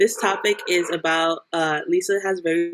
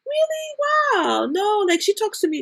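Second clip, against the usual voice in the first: much higher, amazed "Really?"; surprised new girlfriend. Retold or imitated. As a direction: play the new girlfriend hearing surprising news.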